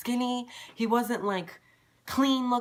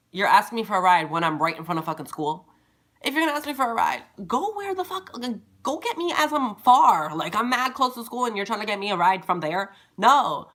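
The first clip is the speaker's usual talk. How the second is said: angry rant; 6 dB louder than usual. Real, not acted. Ranting at the stalker.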